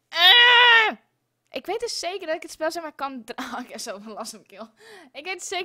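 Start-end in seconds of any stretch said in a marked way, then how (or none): 0.1-1.0 a loud, fed-up shout; frustrated cry. Real, not acted. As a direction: cry out at a broken game. Game keeps crashing, frustrated.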